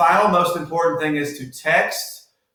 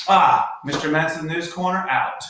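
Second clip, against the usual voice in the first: usual speaking voice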